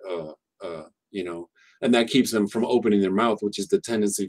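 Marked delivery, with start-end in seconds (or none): 0.1-0.9 "Uh, uh" as a short schwa with the teeth clenched. Shown as a demonstration. Teacher showing students the schwa, teeth clenched. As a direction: say "Uh, uh" with the mouth barely open. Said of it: "have 'em clench their teeth and just say".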